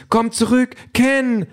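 very high pleading cry; distraught wife calling for her friend. A narrator's reading. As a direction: beg him back in a high wail. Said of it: "ruft Denise".

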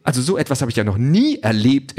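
high, swooping indignant drone; flustered outrage. A narrator's reading. Drone under missile threat, huffily outraged. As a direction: work yourself up as a scandalised robot. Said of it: "echauffiert sich die Drohne".

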